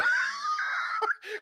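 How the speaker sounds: very high screaming laugh; shrieking laugh. Real, not acted. Friend losing it over his near-drowning, high shrieking laugh. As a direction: let the laugh break into a shriek.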